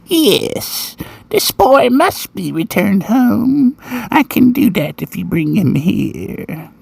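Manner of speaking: put-on high, slow old-lady voice; slow, high-pitched old dear; old woman's voice. A narrator's reading. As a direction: play an elderly woman, drawn out and high. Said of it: "An old woman's voice answered".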